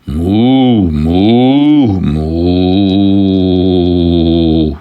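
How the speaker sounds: long, drawn-out moos; slow cow calls, held out; cow mooing. Acted. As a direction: moo like a contented cow. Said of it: "So the cow said".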